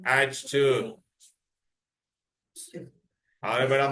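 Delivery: ordinary talk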